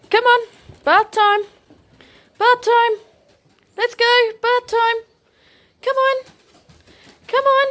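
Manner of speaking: way up high and bright, coaxing the dog; sing-song excited calling; high-pitched, excited call. Shown as a demonstration. Owner coaxing dog to the bath, high-pitched excited call. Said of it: "you say it in a really high-pitched, exciting voice".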